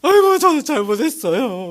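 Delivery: high-pitched mock wailing confession; tearful repentance. Scolded believer repenting to the mentor, acted weeping. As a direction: act out a sobbing apology. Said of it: "울면서 해야 저도".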